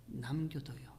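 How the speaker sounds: hushed aside to the congregation; soft whisper. Shown as a demonstration. Preacher repeats the point.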